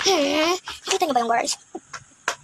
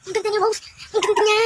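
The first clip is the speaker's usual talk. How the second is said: higher, awed praise; amazed; 6 semitones higher than usual. Acted. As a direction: gasp at her beauty. Amazed at Rose's beauty. (namamanghang papuri)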